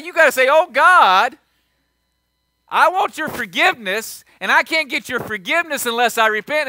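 loud, high preacher's cry; fiery preaching. Acted. Pastor urging the congregation to repent, loud and fervent. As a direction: preach it out with full force.

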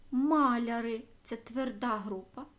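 normal talking voice

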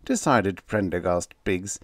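ordinary delivery

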